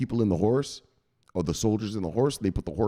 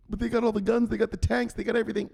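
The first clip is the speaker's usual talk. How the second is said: panicked Zelensky; 13 semitones higher, faster than usual. Retold or imitated.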